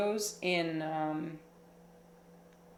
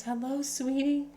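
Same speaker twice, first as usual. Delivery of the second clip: high, cooing greeting; sweet high-pitched hello to a pet; pet talk; 7 semitones higher than usual. Real, not acted. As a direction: greet a beloved pet in a raised voice. Greets pet entering the room.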